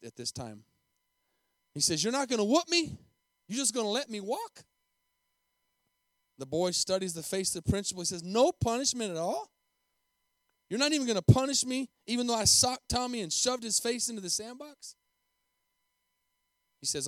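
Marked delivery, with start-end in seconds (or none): 2.0-4.6 put-on high, rising questions of a disbelieving child; incredulous boy. A narrator's reading. Boy asks principal about getting whooped, incredulous. 8.2-15.0 put-on higher, rising voice of a disbelieving child; incredulous boy. A narrator's reading. Boy asks principal about punishment, incredulous.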